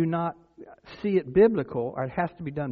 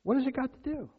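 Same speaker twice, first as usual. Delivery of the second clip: high-pitched mock puzzlement; ironic questioning; 10 semitones higher, 4 dB quieter than usual. Shown as a demonstration. Preacher repeating his rhetorical question, high ironic wonder. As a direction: go high, feigning you have no idea. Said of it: "the irony of what I'm saying".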